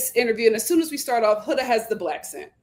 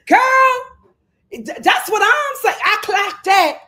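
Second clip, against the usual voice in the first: normal talking voice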